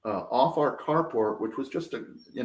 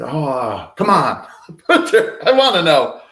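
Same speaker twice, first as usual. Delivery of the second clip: laughing, pleading, a bit louder; laughing plea; 4 semitones higher, 9 dB louder, slower than usual. Retold or imitated. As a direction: beg with a laugh. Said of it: "I said".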